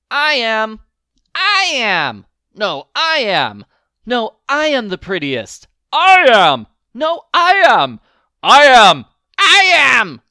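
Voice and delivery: each cat yelling over the rest; squabbling cats. A narrator's reading. Cats quarrelling over who is prettiest, shouting. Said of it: "cried hundreds ... of voices".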